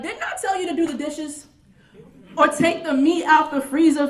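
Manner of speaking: high, emphatic mother's scolding; scolding mama. Acted. Mama scolding the child about house rules, high-pitched telling-off. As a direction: lay down the house rules like an exasperated grandma. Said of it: "they always ask things like".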